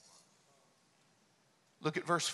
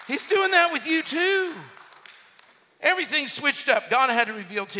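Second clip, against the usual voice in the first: raised, high-pitched driving preaching; preacher in full force; 7 semitones higher, 7 dB louder than usual. Real, not acted. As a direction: pile up the phrases with rising fervour.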